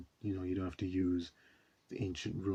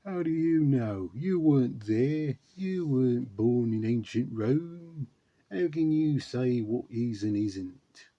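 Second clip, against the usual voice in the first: loud, higher-pitched, drawn-out bad British impression; terrible Ridley Scott; 5 semitones higher, 9 dB louder, slower than usual. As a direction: badly imitate an indignant director, slow and loud. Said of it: "That was a terrible Ridley Scott impression".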